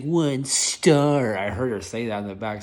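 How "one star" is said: snide mock rating. Girl mocking driver from back seat.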